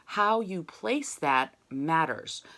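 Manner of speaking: ordinary talk, nothing marked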